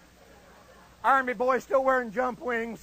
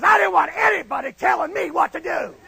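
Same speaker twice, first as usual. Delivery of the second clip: loud, raised-pitch mock indignation; indignant; 5 semitones higher, 8 dB louder than usual. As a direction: belt it out defiantly. Comic indignation recounting why he enlisted.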